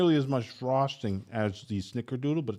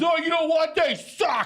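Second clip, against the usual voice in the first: mocking complainer. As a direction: play a huffy customer swearing off the place. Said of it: "this guy's had one bad Papa John's".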